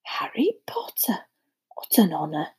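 put-on hushed old barman, honoured; whispered awe. A narrator's reading. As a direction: whisper it in reverence. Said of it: "whispered the old barman".

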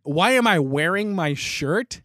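quick high "what", then slow indignant question; ramped-up incredulity. Retold or imitated. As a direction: throw the question back in retold disbelief. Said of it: "it ramped up".

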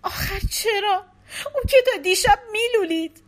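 high, loud tearful protest; crying out in disbelief; wife crying, asking why; through tears. A narrator's reading. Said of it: "از میان اشک گفت".

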